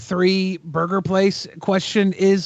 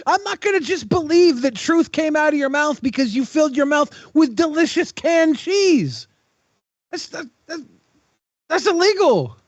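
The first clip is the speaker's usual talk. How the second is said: mock outrage; 11 semitones higher than usual. Mock outrage over cheese defense.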